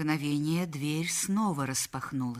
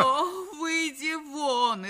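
high, drawn-out wail; hysterical cry; 10 semitones higher, much slower than usual. Acted. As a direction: wail it out, sending them away. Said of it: "истошно закричала Мадлен". (истошный крик)

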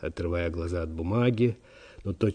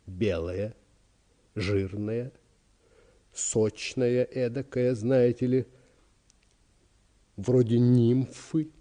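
lingering gourmand reverie; secretary savouring food; 3 semitones higher, slower than usual. Acted. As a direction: relish each word, with long pauses between them. Secretary savours the roast turkey. (смакуя каждое слово, с паузами)

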